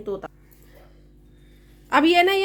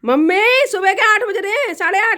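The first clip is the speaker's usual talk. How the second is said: higher and louder, announcing the time; loud wake-up call; 6 semitones higher, 6 dB louder than usual. Real, not acted. Daughter-in-law calling the time to her sleeping mother-in-law, loud.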